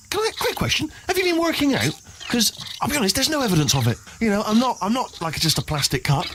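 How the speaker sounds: high, sly mock concern; cheeky teasing. Real, not acted. Robot teases the player about working out, cheeky. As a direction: needle them about their muscles.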